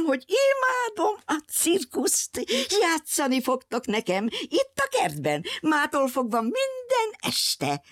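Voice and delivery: high, shrill-free gloating squeal with a giggle; gleeful captor woman. Acted. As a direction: gloat sweetly, slow and high, over her new toys. Captor woman gleefully refuses release.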